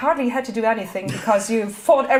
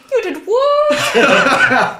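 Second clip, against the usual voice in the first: distant shout; 12 semitones higher, 10 dB louder, much slower than usual. Acted. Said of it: "and there's a faint".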